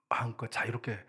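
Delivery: whispered kind offer. Retold or imitated. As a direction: slip it to him in a whisper. Friend quietly handing over meal tickets. (친구가 조용히 속삭이며 건네는 말)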